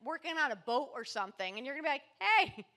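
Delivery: high, loud, fast teasing rant; sister ranting. Retold or imitated. Sister listing what he'd do, high excited rant. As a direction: rattle it off like a sister who knows him. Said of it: "She goes".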